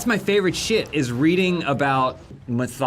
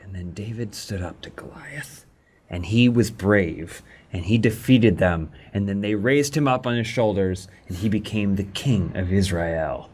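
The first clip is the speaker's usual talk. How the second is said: lower, slow, grand story narration; solemn storyteller; 7 semitones lower than usual. Acted. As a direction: intone it like an old scripture tale. Parodying solemn Bible storytelling.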